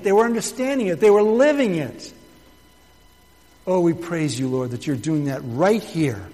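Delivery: loud, fervent pulpit prayer; preaching prayer. Acted. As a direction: pour it out to God with mounting fire. Pastor's fervent congregational prayer.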